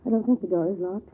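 normal talking voice

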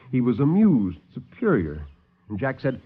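ordinary talk

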